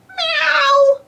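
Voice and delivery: very high, loud mew; cat meow. Acted. Pilchard hearing an owl in the tunnel, high meow. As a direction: mimic a cat's long high meow.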